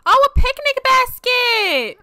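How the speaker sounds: high, loud, drawn-out squeal; delighted excitement. Real, not acted. Delighted by a game item.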